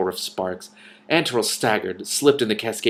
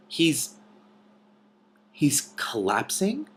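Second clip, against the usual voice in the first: slow, faltering disbelief; stunned, halting; 5 semitones higher, much slower than usual. A narrator's reading. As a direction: trail off in shock. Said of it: "in a stunned voice".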